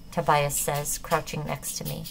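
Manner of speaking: ordinary delivery, nothing marked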